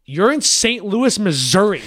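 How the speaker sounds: furious disbelief. Retold or imitated. Scolding the supplier about picking up cans, outraged. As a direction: spell out the absurd distance in outrage. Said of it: "I got furious".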